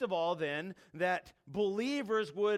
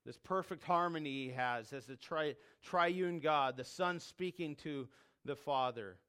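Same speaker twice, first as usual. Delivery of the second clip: normal talking voice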